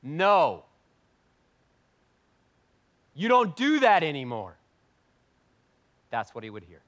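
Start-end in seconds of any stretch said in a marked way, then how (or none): 0.0-4.6 mock dismissive church. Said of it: "what would happen in the American church".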